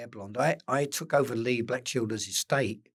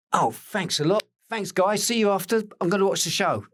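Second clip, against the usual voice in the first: high, loud, bright thank-you; 9 semitones higher, 6 dB louder than usual. Retold or imitated. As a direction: thank them on the way out. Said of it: "And he goes".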